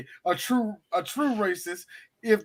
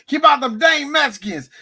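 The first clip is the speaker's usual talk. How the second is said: mock hillbilly; 6 semitones higher, 6 dB louder than usual. Quoting cartoon hillbillies building a wall.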